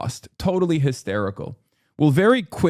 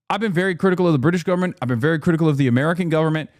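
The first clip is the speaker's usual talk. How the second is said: rapid-fire emphasis; 3 semitones higher, much faster than usual. Real, not acted. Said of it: "repeated for emphasis".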